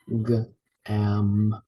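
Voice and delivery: "G-am-ma-a" broken into drawn-out separate sounds; sounding out. Shown as a demonstration. Teacher sounding out gamma, slow separate letter sounds. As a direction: sound out "G-am-ma-a" piece by piece. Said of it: "sound it out".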